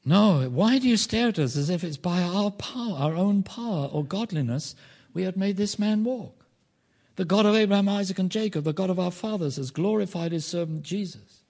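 loud, high, full-force sermon delivery; impassioned preaching. Acted. Preacher declaring the apostles' words to the congregation, loud and impassioned. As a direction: proclaim it forcefully to the whole room.

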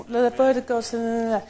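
slow, flat, half-hearted reading of The word of God; bored rote recital; listless mumble. Shown as a demonstration. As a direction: drone The word of God without meaning it. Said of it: "Don't just".

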